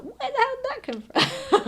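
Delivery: a viewer stunned by a sudden threat; shocked disbelief. Retold or imitated. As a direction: blurt it out like you can't believe what you heard. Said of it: "And you're just like".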